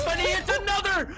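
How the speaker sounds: high, loud hype call; excited shout. Real, not acted. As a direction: shout the big play. Caster calls a big shot.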